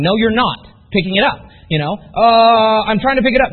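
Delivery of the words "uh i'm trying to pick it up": mock effortful grunt on the pickup; mock strain. Mocking a recruit who only tries to lift a pin, strained. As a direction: fake a strain lifting the pin.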